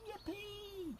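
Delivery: very high, soft whoop; gleeful cry. A narrator's reading. T-rexes see the fallen tree bridge, high soft whoop. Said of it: "cried out".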